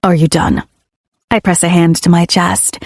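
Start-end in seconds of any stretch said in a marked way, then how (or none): 0.0-0.6 low male character voice; lower, gruff man's reply; deeper male voice. A narrator's reading. Ledger answers her, male voice.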